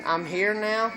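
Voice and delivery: ordinary talk, nothing marked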